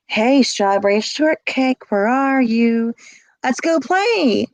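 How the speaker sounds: slow, high, coaxing call of the abuser; creepy abuser voice. Retold or imitated. Abuser calling the hiding girl, slow creepy coaxing.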